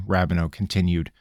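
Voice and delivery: usual speaking voice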